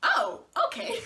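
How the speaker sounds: mock realization with a laugh; surprised Oh okay. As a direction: caught off guard, lightly amused. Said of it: "I was like".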